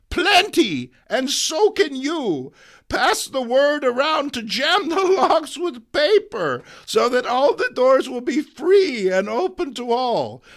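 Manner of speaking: put-on high, loud instructing old lady; high old woman giving battle orders; rallying granny. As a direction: spread the plan like a ringleader. May gives orders.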